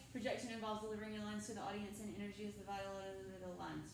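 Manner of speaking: fast, quiet, mumbled run through the lines; mumbled reading. Retold or imitated. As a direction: rattle it off under your breath with no energy. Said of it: "Or did she just say".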